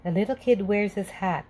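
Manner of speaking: ordinary delivery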